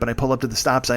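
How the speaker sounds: ordinary delivery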